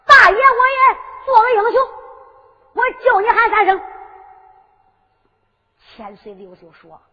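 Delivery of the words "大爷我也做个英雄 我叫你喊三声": high, loud boastful declaration; grand concession. Acted. Su Long grants three shouts, boastful and loud. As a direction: announce it grandly at full voice.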